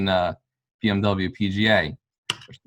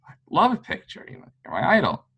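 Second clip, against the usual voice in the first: higher, excited fanboy gush; starstruck gushing; 10 semitones higher than usual. Retold or imitated. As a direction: squeal it like a starstruck fan meeting his idol. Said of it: "I'm like".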